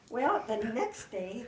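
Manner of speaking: ordinary talk, nothing marked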